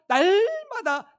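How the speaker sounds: "날마다"의 첫 음절을 길게 늘여 힘주어; "날마다"를 길게 끌며 강하게 강조. Real, not acted. 다윗의 발견을 강조. ("날마다"에 힘을 실어 길게)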